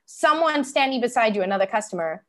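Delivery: ordinary delivery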